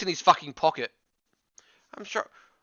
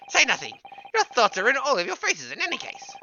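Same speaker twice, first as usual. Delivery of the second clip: theatrical gentleman detective; 3 semitones higher than usual. Acted. Detective greeting his returning helpers, high and theatrical. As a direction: play the flamboyant detective. GM continues Sholmes voice.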